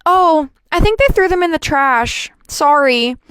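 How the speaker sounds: nurse voice. Retold or imitated. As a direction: play the nurse offhandedly apologising. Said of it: "And I hear her go".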